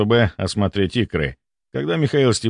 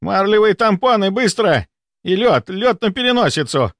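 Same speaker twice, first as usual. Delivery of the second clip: high-pitched emergency orders; urgent yell; 10 semitones higher than usual. A narrator's reading. Volkonsky demands gauze and ice, urgent yell. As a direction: fire off orders in a high, alarmed voice. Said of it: "заорал Волконский".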